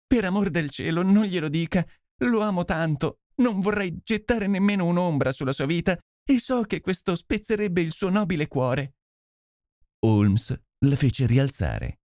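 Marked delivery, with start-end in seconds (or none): 0.1-8.9 higher, begging voice of a desperate wife; distraught pleading. Acted. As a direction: plead with him not to tell, voice lifted high. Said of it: "sconvolta e supplichevole". (supplica sconvolta, voce acuta)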